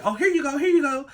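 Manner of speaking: high put-on hurried handover; mimicked cousin. Cousin suddenly finds the keys, high mimicked voice.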